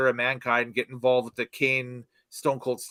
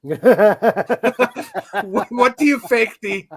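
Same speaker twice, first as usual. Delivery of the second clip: breaks into high laughter while asking; words squeezed out through laughter; laughing; 6 semitones higher, 7 dB louder, much slower than usual. Real, not acted. Host laughing mid-question.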